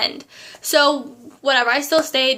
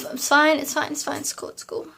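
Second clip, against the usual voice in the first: fading mutter; 5 dB quieter than usual. Real, not acted. Trailing off, muttering to herself.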